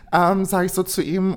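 ordinary talk, nothing marked

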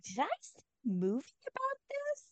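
high, soft voice of dawning recognition; puzzled wondering. Retold or imitated. Reviewer recalling vague bells while watching, high puzzled self-questioning. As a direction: wonder to yourself in a tiny high voice. Acting out her own puzzled thought.

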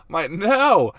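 high, loud protest; hammed-up helpless backtracking; mock exasperated denial. Husband protesting to his offended wife, mock dismay.

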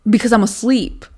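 exasperated retort to partner. Real, not acted. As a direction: snap back in frustration. Exasperated argument.

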